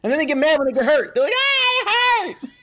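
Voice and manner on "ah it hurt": put-on high wailing kid cry; mock child wail, much higher; whiny kid. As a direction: imitate a kid crying it hurt. Kids whining after a fight.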